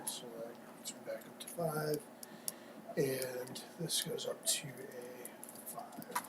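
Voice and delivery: soft whispered counting. Real, not acted. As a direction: whisper it to yourself. Counting under breath.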